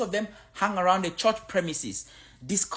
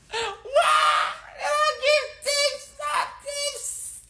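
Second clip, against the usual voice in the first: mock outraged cry; 3 dB louder, much slower than usual. Mock outraged African reaction.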